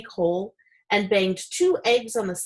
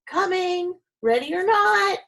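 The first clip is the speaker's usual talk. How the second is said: much higher, loud call; yelling; 11 semitones higher, 4 dB louder than usual. A narrator's reading. Farmer calling to the hidden demon, high and loud. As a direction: call it out like a game of hide and seek. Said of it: "yelling all the while".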